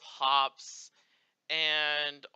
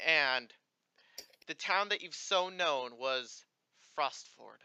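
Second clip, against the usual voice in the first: game master narration. Acted. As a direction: let each word hang like a distant voice. GM voices the sky.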